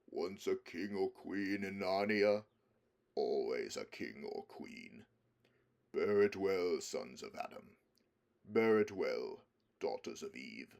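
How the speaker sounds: deep, slow royal blessing; solemn lion. Acted. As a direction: bless them with grave majesty. Aslan's solemn coronation blessing.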